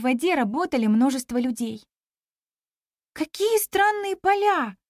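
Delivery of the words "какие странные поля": high, drawn-out wonder; surprised. A narrator's reading. Girl marvelling at the flooded fields, surprised. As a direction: marvel at it in a higher, slower voice. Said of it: "удивилась я".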